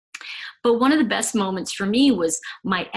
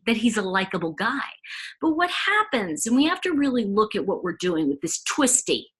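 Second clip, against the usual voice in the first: ordinary delivery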